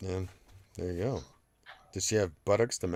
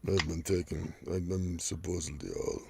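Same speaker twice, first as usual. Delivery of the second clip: lowered, mock-manly Russian voice; deep Russian woman; 4 semitones lower, 5 dB quieter than usual. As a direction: imitate a mannish weightlifter girl, low and subdued. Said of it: "like, deep Russian thing going on".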